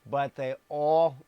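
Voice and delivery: "all" loud and drawn out; stress on "all". Real, not acted. Preacher reading scripture to his audience, stress on "all". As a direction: lean hard and slowly on "all". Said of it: "emphasis on the word".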